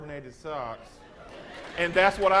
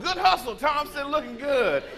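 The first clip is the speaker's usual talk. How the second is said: higher, louder mock coach cheering; a coach hollering encouragement from the sideline; coach praise; 10 semitones higher, 5 dB louder than usual.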